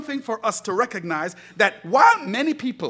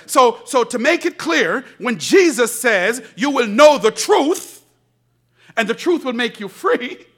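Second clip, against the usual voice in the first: preaching build; 3 semitones higher, 7 dB louder than usual. Acted. Preaching climax.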